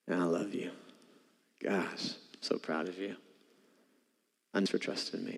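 soft, hushed, tender and proud; tender loving God. Shown as a demonstration. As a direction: say it gently like smiling at a child. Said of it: "see him do this to you today".

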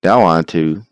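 loud upbeat podcast promo read. Acted. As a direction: read it like a sponsor plug.

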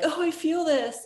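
imitating viewers gushing in recognition; relating emotionally. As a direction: cry out as if deeply moved. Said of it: "the women who watched it were like".

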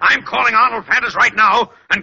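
father threatening to cut his son from the will, heated; heated threat. Acted. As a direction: slam the threat down on him.

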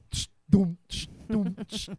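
beatbox a dumb simple punk beat; drum beat imitation. Teasing drummers to the listeners, a mocking beatboxed drum beat.

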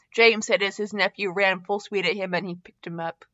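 ordinary delivery, nothing marked

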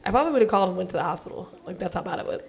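ordinary delivery

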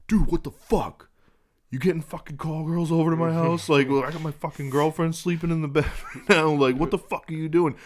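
high, loud imitated scolding; mock outrage. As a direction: act out an indignant brother confronting his dad. Brother acting out angry confrontation.

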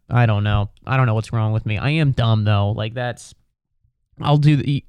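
ordinary talk, nothing marked

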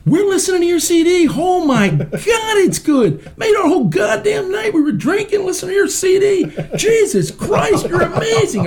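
put-on old doctor calling a patient, high-pitched and overjoyed; gushing old doctor. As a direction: rave about the CD in a squeaky old-man voice. Retold doctor raving about CD.

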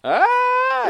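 shocked yelp. Real, not acted. Reacts to the price.

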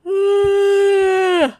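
long, high, loud yawning sigh; a huge sleepy yawn mid-chat. Real, not acted. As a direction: let a big yawn out. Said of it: "Sorry, I keep yawning".

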